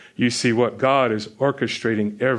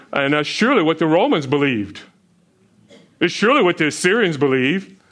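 fervent preaching; 4 semitones higher than usual. Acted. Preaching build on arrogant kings.

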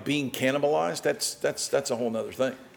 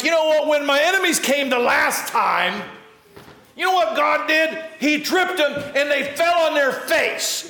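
much higher, excited retelling; psalmist's testimony; 11 semitones higher, 3 dB louder than usual. Retold or imitated. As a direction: boast of what God did. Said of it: "he's like".

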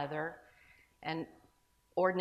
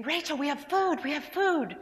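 higher and louder, choked with tears; tearful joy; 9 semitones higher, 7 dB louder than usual. Retold or imitated. Mother telling her daughter they have food, tearful. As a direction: cry out the good news through tears. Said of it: "I started sobbing".

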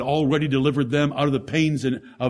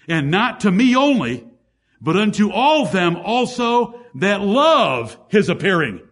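raised, louder preacher's cadence; preaching; 7 semitones higher, 5 dB louder than usual. Acted. Preacher building to the congregation, raised and louder.

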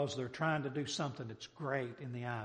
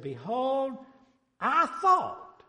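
loud, high cry of wounded pride; wroth Naaman; 10 semitones higher, 8 dB louder, much slower than usual. Acted. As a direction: declaim it indignantly, pitched way up. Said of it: "Wroth, angry".

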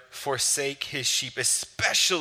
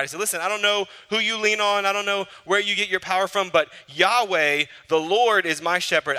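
lightly voiced bragging David; 6 semitones higher, 4 dB louder than usual. David boasting to others about God, lightly played. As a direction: brag about your shepherd.

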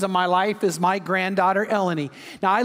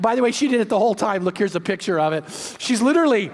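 usual speaking voice

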